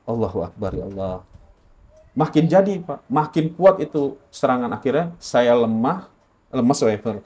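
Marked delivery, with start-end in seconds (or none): 0.0-1.2 softly murmured plea to God; hushed, private invocation; inward prayer. Retold or imitated. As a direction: recall a silent prayer, low and soft. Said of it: "Saya cuma dalam hati".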